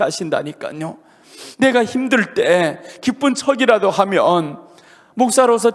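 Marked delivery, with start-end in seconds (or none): none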